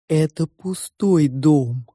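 slow, drawn-out sow voice; probing pig. A narrator's reading. The pig judges the girl's drawing, probing. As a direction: state it slowly, stretching every word.

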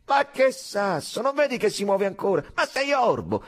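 high, loud, exasperated retort; heated contradiction. A narrator's reading. Soldier contradicts his comrade on the parapet, exasperated. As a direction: shout the other man down.

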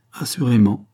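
usual speaking voice

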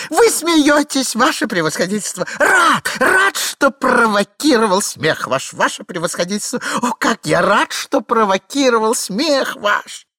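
overjoyed. Acted. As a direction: gush with relief at the laughter. Обрадован, что генерал смеётся. (восторженно, высоким голосом)

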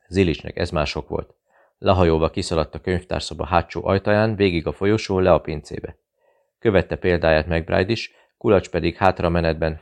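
plain reading voice

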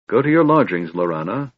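plain reading voice

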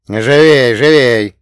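loud, hurrying command; urging on. A narrator's reading. Madam hurries the delivery men down corridor, urging on. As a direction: chivvy them along loudly. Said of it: "подгоняла нас Матильда".

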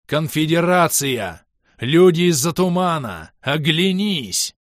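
high, loud, drawn-out warning; urgent shout. A narrator's reading. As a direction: yell to make an enemy see the danger. Shouting warning at raging foe.

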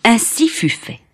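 ordinary delivery, nothing marked

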